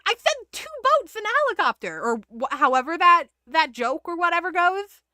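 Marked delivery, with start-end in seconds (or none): 0.1-2.0 much higher, fast exasperated punchline; exasperated God. Retold or imitated. God answering the complaining soul, exasperated high retort. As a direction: deliver God's punchline in quick disbelief. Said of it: "And God's like".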